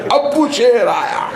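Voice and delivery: high, slow child's mock cry; hammed-up child alarm. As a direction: call it out like a giggling kid. Child announcing father again. (बच्चे की नकली डरी आवाज़, ऊँची और धीमी)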